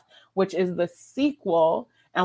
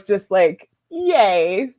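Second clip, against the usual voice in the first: mock giddy cheer; little cheer; 6 dB louder, much slower than usual. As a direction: squeal of childish delight. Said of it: "my heart and the young Bonnie and me was".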